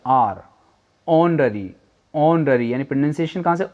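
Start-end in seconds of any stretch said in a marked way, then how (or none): 0.1-0.4 "आर" started straight on the vowel; silent H. Shown as a demonstration. Teacher explains the silent letter, "आर" with no H. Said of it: "हम ओ से शुरू करें". 1.1-2.7 "ऑनरी" repeated, each starting on O; silent H. Shown as a demonstration. Teacher shows where pronunciation starts, "ऑनरी" with no H. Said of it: "ओ से आ रहा है".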